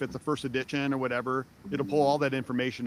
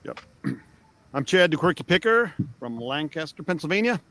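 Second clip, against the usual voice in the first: ordinary delivery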